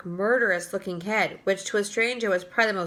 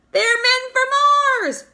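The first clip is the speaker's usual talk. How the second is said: much higher, loud crowd shout; 13 semitones higher, 8 dB louder than usual. A narrator's reading. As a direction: cry it out in thrilled alarm. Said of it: "they shouted".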